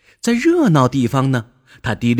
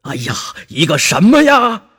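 impatient; 8 semitones higher, 5 dB louder than usual. A narrator's reading. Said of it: "又气又急".

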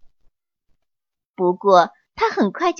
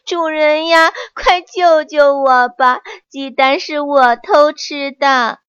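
louder, higher wailing plea; 4 semitones higher, 5 dB louder than usual. A narrator's reading. Monkey wails to the master, confessing loudly. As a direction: cry out begging for help. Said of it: "哇哇大哭起来".

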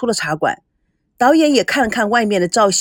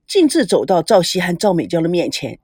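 ordinary delivery, nothing marked

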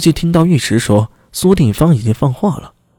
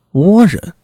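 higher-pitched disbelieving repeat; startled echo; 5 semitones higher, 4 dB louder than usual. Acted. Officer echoing the magistrate's unexpected word, startled. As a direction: blurt it back, taken aback.

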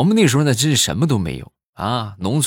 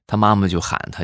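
normal talking voice

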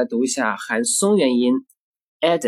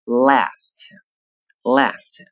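"last" with a flat front "a", as in "cat", American style; American accent. Shown as a demonstration. Teacher showing the American "last" after the British one. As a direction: say "last" the American way, mouth spread. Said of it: "到美式里面呢，就发作".

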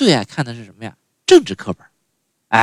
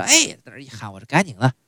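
usual speaking voice

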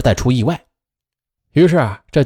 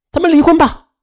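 much higher, loud cry; divorce demand; 4 dB louder than usual. A narrator's reading. Betrayed wife to lying husband, shrill outburst. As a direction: fling the word divorce at him.

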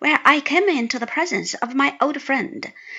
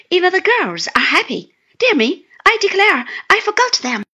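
a hint of a bubbly, eager young man, louder; lightly voiced happy newlywed Traddles, louder; 7 semitones higher, 6 dB louder, faster than usual. A narrator's reading. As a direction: Traddles proudly showing off his bride. Narrator voicing Traddles.

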